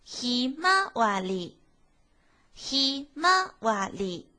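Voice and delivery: 「ひまわり」只有第二拍高，放慢; 慢读ひまわり，第二拍突出. Shown as a demonstration. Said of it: "然后是二调". (「ひまわり」二调慢读)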